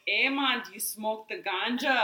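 put-on Jamaican accent; hammed-up Jamaican accent; imitated Jamaican accent. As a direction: mimic someone doing bad Jamaican. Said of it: "they had a Jamaican accent".

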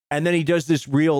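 ordinary delivery

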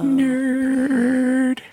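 high drawn-out teasing nerd. Real, not acted. Host teasing Brandon over Star Wars, long high mocking call. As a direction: stretch it out high to mock.

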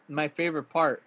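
usual speaking voice

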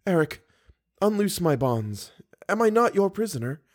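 high-pitched, submissive plea; pleading captive. A narrator's reading. Bound captive pleads with captor.

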